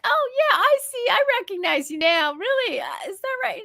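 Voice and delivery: high-pitched, playing along with kids; humouring amazement. Woman answering children who name their father, high feigned amazement. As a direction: act impressed in a high sing-song reply.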